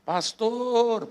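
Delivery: "Pastor" drawn out on a high pitch; panggilan panjang. Retold or imitated. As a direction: stretch the last syllable of "Pastor", high then falling. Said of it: "panjang gitu". ("Pastor" dipanjangkan dengan nada tinggi)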